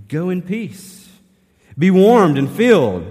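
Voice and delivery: a loud brush-off blessing, pitched high, slow on Go; dismissive blessing. Acted. Pastor mimicking someone brushing off a poor man, loud dismissive blessing. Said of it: "the emphasis is on go".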